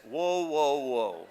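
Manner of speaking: wife's slow, stern stop sign; a hint of a stern wife; warning whoa. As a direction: halt him flatly, dead face. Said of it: "she says".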